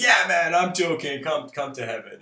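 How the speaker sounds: high, very loud jovial shout; God. Acted. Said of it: "God looks at the Joker and says".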